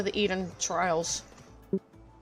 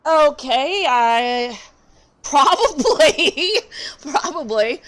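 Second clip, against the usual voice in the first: laughing; 6 semitones higher, 9 dB louder, much slower than usual. Real, not acted. Laughing while reading game text.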